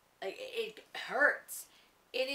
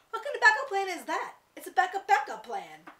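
higher, louder exasperated scoff; indignant disbelief; 10 semitones higher, 8 dB louder than usual. Acted. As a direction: toss it back as a ridiculous idea. Character scoffs at the plan.

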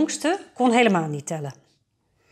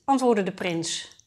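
usual speaking voice

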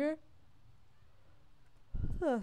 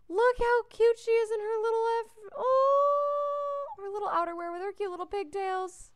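squealing over cuteness; 11 semitones higher, 7 dB louder than usual. Real, not acted. Player squealing over a cute girl in pigtails, high and loud. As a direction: gush like seeing a puppy.

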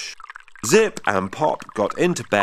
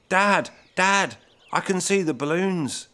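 put-on higher, eager child calling out; bursting to tell Dad; a thrilled little voice, higher; excited; 8 semitones higher than usual. A narrator's reading. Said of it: "He was excited".

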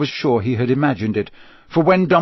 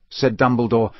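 ordinary delivery

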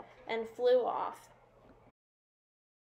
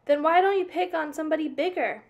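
challenging shout; 5 semitones higher, 6 dB louder than usual. A narrator's reading. Ladybugs quarrel.

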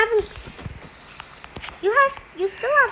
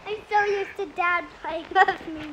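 ordinary talk

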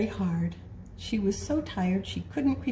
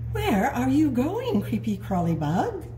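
ant voice; 5 semitones higher, 5 dB louder than usual. Acted. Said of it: "an ant who said".

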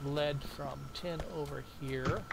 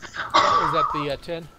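laughing; 12 dB louder, slower than usual. Real, not acted. Laughing question.

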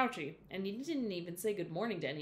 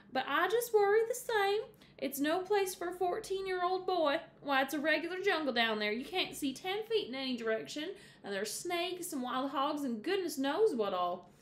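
worried Mama; 8 semitones higher than usual. A narrator's reading. Mama warning Jay Barry of the jungle, higher and worried. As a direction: warn him of snakes and hogs, fretting.

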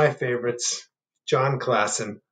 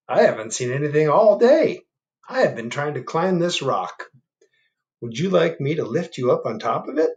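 a touch higher, chatty frog; lightly voiced frog; 5 semitones higher, 5 dB louder than usual. Frog offering to lift the turtle, lightly voiced. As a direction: offer help cheerfully.

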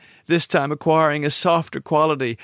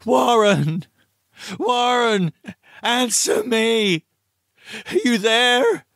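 high-pitched call down the line; acted cry for an answer, drawn out; desperate calling; 9 semitones higher, slower than usual. Narrator calling Warren into the telephone, desperate cry. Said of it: "and screamed".

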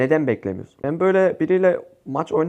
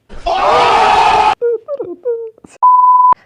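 shrieking, much higher and louder laugh; screaming laugh; 9 dB louder than usual. Real, not acted. Gamer celebrating a win on stream: shrieking laugh. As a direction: burst out in wild laughter. Loud burst after the win.

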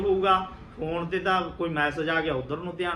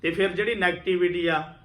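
ordinary talk, nothing marked

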